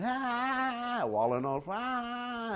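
high, far-off imitated wail; hammed-up child tantrum cry; mock tantrum wail. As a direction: wail like a bratty kid throwing a fit. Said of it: "he's just kicking and screaming".

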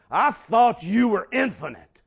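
loud, high, scornful outburst; disdainful shout. Retold or imitated. Habakkuk scornfully shouting his complaint at God. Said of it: "it comes off like this".

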